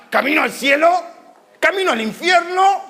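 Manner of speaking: high, insistent samurai interrogation; urgent demanding questions. Acted. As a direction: demand an answer from frightened strangers. Samurai accosting people with his sword. (preguntando con urgencia, en tono agudo)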